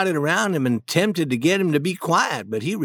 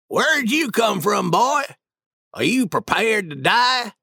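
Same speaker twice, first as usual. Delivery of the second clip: put-on 1 drunk bully; 5 semitones higher than usual. Drunk Boggs threatens the boy.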